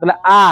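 আ said as a plain hamza instead of a throaty ain; wrong, like hamza. Shown as a demonstration. Teacher mimics students' hamza-like ain. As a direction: imitate students saying আ with no throat sound. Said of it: "আইনকে হামজার মতো বলে".

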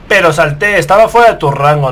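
loud protest at the game; frustrated protest. Real, not acted. Gamer arguing with the game after a hit, loud protest. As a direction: protest loudly that you jumped.

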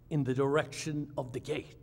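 usual speaking voice

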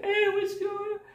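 very high put-on voice; squeaky-high pretend bystander piping up; fake crowd member. Gil pretending to be someone in the crowd, high fake voice. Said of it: "pretending to be people in the crowd".

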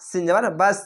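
ordinary talk

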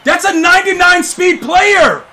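loud, high, incredulous yelling; high-pitched gamer outrage, stretching the final words; outraged shout. Real, not acted. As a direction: yell in disbelief at an unfair play. Outraged at a play in game.

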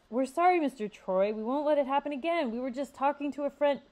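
high, loud rushed excuse; frantic apology. A narrator's reading. Xavier apologizing to Mister Troy, high, loud and rushed. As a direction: hurry the apology out. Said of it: "Xavier frantically said".

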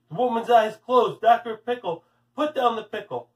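ordinary delivery

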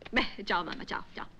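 whispered goodbye. Real, not acted. Hanging up on offended mother.

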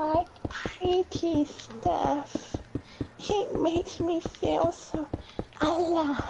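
very high, drawn-out character voice; slow, high-pitched mock delight; put-on silly voice. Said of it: "in that sort of voice".

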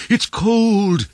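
high, loud squeal; high-pitched bather's yelp; excited cry. A narrator's reading. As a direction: cry out from the cold water. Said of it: "they cried".

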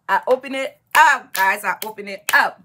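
loud, rising excitement, repeating it; excited. Real, not acted. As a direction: burst out with the good news. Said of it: "Guess what?".

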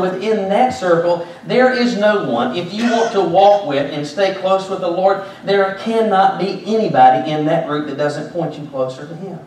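loud, high-pitched sermon delivery; preaching at full force. Real, not acted. Preacher urging the congregation to stay close to the Lord, loud preaching.

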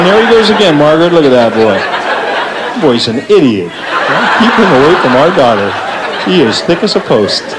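gossiping neighbor. Retold or imitated. Neighbor gossiping to Margaret about the boy, lightly voiced and low. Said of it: "Your neighbors would be out there going".

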